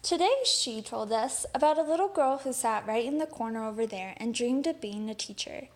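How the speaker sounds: ordinary delivery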